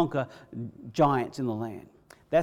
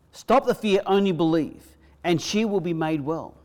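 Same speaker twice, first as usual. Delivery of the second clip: higher, louder command, stop hit hard; strong voice; 3 semitones higher, 4 dB louder than usual. Acted. Jesus telling Jairus in a strong voice, stop the fear. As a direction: speak it with full authority. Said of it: "he told him in a strong voice".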